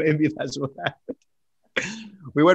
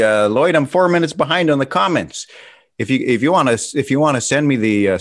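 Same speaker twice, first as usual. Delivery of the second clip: normal talking voice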